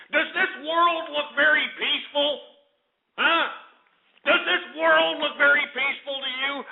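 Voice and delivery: high-pitched, incredulous questioning; indignant challenge. Real, not acted. Sarcastic rant at United Nations.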